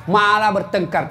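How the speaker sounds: ordinary delivery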